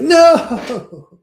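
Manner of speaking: loud, high, drawn-out "no"; mock despairing cry. Director reacts to another flubbed take, mock anguished shout. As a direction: wail it out in comic despair.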